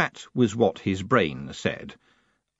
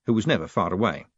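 ordinary delivery

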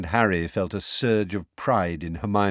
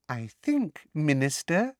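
toady teacher cutting in silkily; silky; 6 semitones higher than usual. A narrator's reading. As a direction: purr it sweetly to the minister. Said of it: "said Umbridge silkily".

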